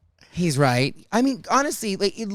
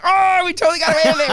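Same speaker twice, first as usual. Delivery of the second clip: high, louder, fast gleeful rush; giddy with getting away with it; excited; 12 semitones higher, 7 dB louder, much faster than usual. Retold or imitated. Gloating to Tiffany after security, high and excited. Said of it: "So excited".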